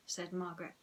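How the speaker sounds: plain reading voice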